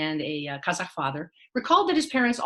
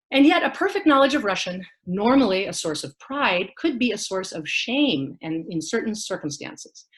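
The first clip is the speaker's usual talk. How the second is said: ordinary delivery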